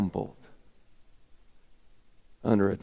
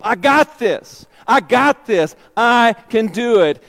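loud, high, stubborn insistence; cocky self-reliance; 8 semitones higher, 9 dB louder than usual. Acted. Peter refusing God's help, loud stubborn pride. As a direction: brush off God's help proudly.

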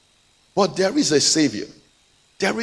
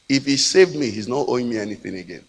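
normal talking voice

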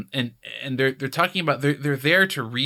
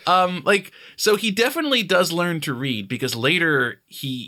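ordinary talk

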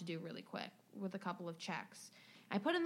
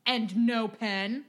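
slow, louder, indignant drawl; huffy complaint; 7 dB louder, much slower than usual. Retold or imitated. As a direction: drag out the complaint like an annoyed customer. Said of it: "And he goes".

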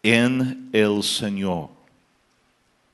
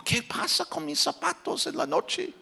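puzzled son; 6 dB quieter than usual. Retold or imitated. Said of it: "Él dijo".

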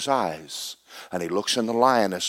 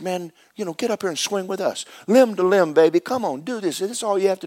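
much higher, loose jive monkey voice; cool monkey voice; 10 semitones higher than usual. Acted. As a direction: swing it high like a slick monkey talking.

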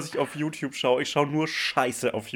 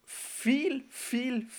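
high emphatic repetition; 12 semitones higher, 5 dB quieter than usual. Real, not acted.

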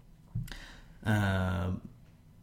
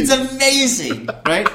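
big high-pitched rave; delighted amazement; 13 semitones higher, 9 dB louder than usual. Real, not acted. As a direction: stretch it out in gleeful disbelief. Echoes co-host's delighted amazement.

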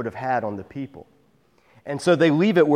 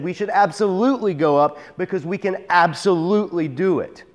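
insistent, emphatic quote; 5 semitones higher than usual. Retold or imitated. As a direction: stress on "absolutely" both times. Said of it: "It's very emphatic".

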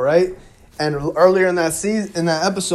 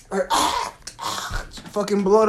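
imitated choking gasp; 5 semitones higher than usual. Acting out Kaz's throat being slit.

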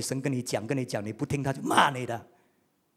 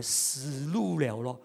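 ordinary talk, nothing marked

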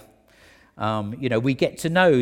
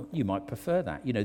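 ordinary delivery

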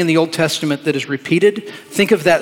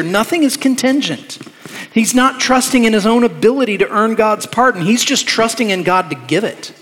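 preaching with force; 5 semitones higher than usual. Real, not acted. Preacher to congregation, rising with force. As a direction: drive the point home, building.

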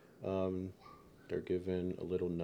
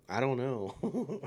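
laughing through the words; 5 semitones higher, slower than usual. Real, not acted. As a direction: chuckle while admitting it. Laughing at own ignorance.

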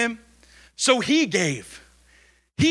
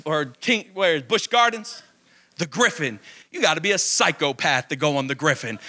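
ordinary delivery

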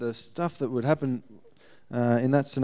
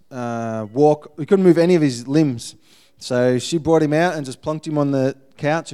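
ordinary delivery

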